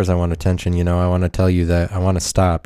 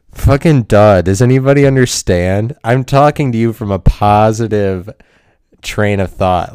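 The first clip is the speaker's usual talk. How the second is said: exasperated outburst; 6 dB louder than usual. Acted. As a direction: burst out loudly, fed up with your listeners. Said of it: "angry about".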